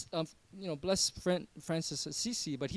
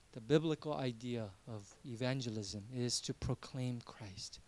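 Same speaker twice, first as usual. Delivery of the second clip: normal talking voice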